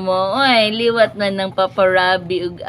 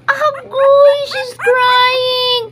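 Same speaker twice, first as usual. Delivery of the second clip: much higher, louder whiny kitten voice; mock kitten crying; 13 semitones higher, 5 dB louder, much slower than usual. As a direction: pretend to be the kitten wailing. (tingog sa iring nga nagkunwaring naghilak)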